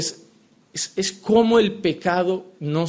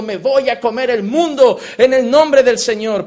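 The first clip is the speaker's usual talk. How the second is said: mock triumphant cry; 4 semitones higher, 4 dB louder than usual. Mocking believers leaving church elated.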